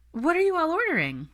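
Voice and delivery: high, haughty socialite asking the table; a lofty, condescending question; snooty friend at brunch; snobbish Lisa voice. Acted.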